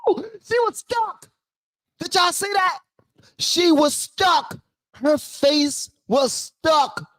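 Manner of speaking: very high, drawn-out crowing; stretched-out high squeal of amazement; gleeful disbelief. Real, not acted. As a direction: crow it slowly in a high, amazed voice. Gleeful reaction to her frozen answer.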